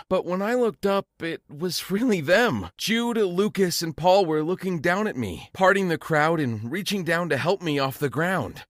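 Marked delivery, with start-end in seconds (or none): none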